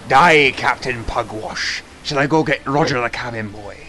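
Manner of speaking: generic pirate. Pretend. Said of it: "I'm a generic pirate".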